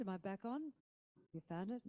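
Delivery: normal talking voice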